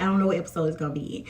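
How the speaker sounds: rushed and quiet; speeding up. Real, not acted. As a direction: race through it, under your breath. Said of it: "Yeah, I'm speeding up".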